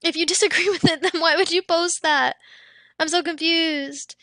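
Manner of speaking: ordinary talk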